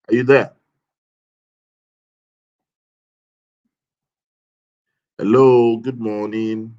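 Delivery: loud call to a caller on the line. Real, not acted. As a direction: raise the voice to reach the caller. Host calling to absent caller.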